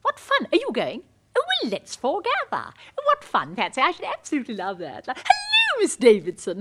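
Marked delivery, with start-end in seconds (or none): 5.1-6.6 high, hooted hello; hammed-up delighted hail; effusive greeting. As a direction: call out a gushing social greeting. Calling greeting to an acquaintance.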